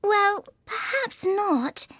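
high, slow, placating voice; gentle high-pitched concession; soothing tone. A narrator's reading. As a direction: soothe an upset creature slowly. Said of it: "said Alice in a soothing tone".

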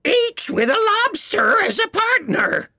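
very high, excited creature cry; Gryphon crying out. A narrator's reading. Gryphon chiming in with dance instructions, crying out. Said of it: "cried the Gryphon".

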